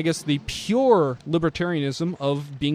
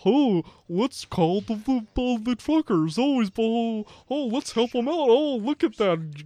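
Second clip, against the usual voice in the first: put-on wholesome 1950s kid; 5 semitones higher than usual. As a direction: play a starry-eyed wholesome kid. Mocking a naive viewer.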